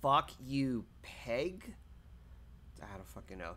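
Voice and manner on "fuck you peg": ordinary delivery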